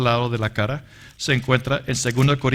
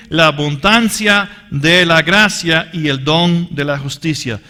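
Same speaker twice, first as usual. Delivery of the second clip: raised, emphatic reading of the verse; scripture reading; 3 semitones higher, 4 dB louder than usual. Acted. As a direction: proclaim the verse with weight. (lectura enfática del versículo)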